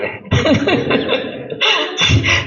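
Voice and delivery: a light airy giggle at himself; high breathy laugh. Real, not acted. Preacher laughs at his own tears to the congregation, airy giggle.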